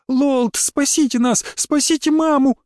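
put-on high, slow pleading child voice; a scared girl begging through tears; crying little girl. A narrator's reading. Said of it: "Из глаз её текли слёзы".

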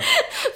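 a bright, high burst of laughter. Acted. Sabine laughs at Fortunio's teasing, a high laugh. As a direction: laugh high, delighted at his words.

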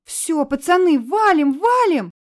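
high, loud panicked shriek. Acted. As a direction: shriek at them to run. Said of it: "верещали девчонки".

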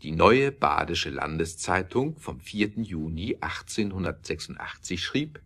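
ordinary talk, nothing marked

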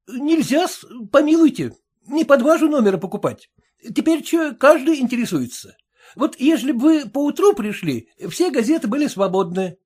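a tavern keeper excusing himself briskly; barkeeper voice. Acted.

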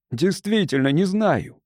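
plain reading voice

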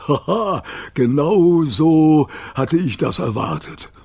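a slow, laughing dog voice; dog Rover. A narrator's reading. Rover the dog, pleased with himself, slow chuckle. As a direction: let Rover chuckle knowingly.

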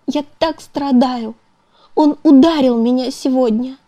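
high, strained voice on the edge of tears; tearful pleading. A narrator's reading. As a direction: pour out her pain, close to sobbing. Beaten wife confesses in tears. (в слезах, надрывно)

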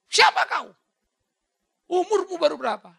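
high, loud furious challenge; the Pharisees shout at Jesus in rage; raging Pharisees. Retold or imitated. Pharisees yelling angrily at Jesus, high and loud. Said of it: "Ngamuk mereka".

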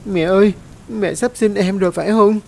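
put-on high, tearful child's question; a scared child on the verge of tears; whimpering child. A narrator's reading. Frightened child asks her mother in labour, whimpering. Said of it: "mếu máo".

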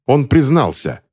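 high, triumphant exclamation. A narrator's reading. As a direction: announce it as a victory. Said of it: "торжествующе воскликнул человек в сером".

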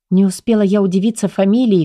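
ordinary delivery, nothing marked

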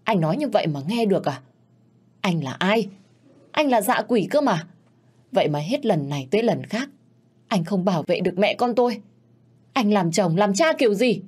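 wife screaming at her husband over their son; anguished fury. Acted. Wife pounds chest of husband.

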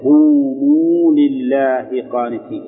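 verse recited. Shown as a demonstration. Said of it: "مثل أيضًا إذا اتصل به واو جماعة".

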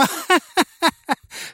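high, guffawing cackle; mocking cackle. A narrator's reading. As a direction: cackle at him from the dark. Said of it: "Хохотал голос".

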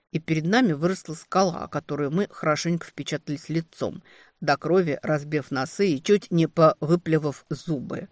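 ordinary delivery

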